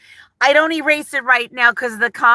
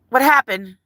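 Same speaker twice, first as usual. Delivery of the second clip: normal talking voice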